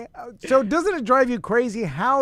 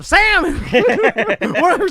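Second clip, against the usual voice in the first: incredulous; 8 semitones higher, 5 dB louder than usual. Retold or imitated. Scoffing at kid's salmon order.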